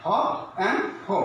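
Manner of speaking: slow "hop and ho", open then rounded O; hop versus ho. Shown as a demonstration. Teacher showing learners the two O sounds. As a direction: draw out "hop" and "ho" side by side. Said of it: "look, dear learners".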